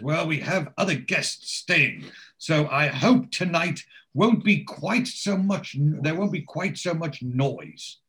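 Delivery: measured, pointed complaint; prissy landlord. Retold or imitated.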